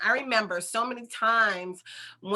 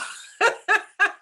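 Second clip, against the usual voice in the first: a sudden high laugh, loud; high burst of laughter; 12 semitones higher, 6 dB louder than usual. Real, not acted. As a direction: laugh out at yourself. Laughs at own aside.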